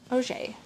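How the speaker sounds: softly tried "[name]", oh-ZHAY; French pronunciation. Shown as a demonstration. Reader practising to herself, "[name]" softly as oh-ZHAY. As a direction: murmur "[name]" to yourself as oh-ZHAY. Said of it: "My surname [name] is pronounced".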